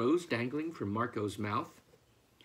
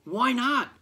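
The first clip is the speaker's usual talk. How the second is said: high, loud demand; indignant cry; 10 semitones higher, 7 dB louder than usual. A narrator's reading. Farmer challenging the duck's warning, high loud cry. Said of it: "cried Farmer McPhee".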